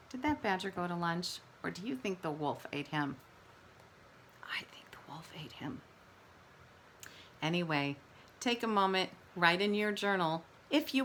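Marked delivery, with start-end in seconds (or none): 4.4-5.8 hushed conspiratorial whisper; teacher whispering a spooky guess to her class. Acted. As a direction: lean in and whisper it like a secret. Conspiratorial whispered answer to kids.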